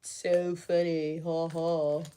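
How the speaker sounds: sarcastic deadpan. Acted. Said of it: "I forgot to laugh".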